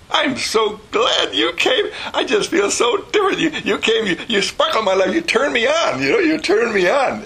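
put-on high-pitched, overjoyed baby-talk gush; gushing grandmother. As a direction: play a grandmother bubbling over at the baby.

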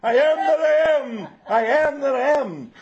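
very loud and high, sustained; loud model for the choir; full volume. Shown as a demonstration. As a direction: belt it out like a choir. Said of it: "When I raise my hand".

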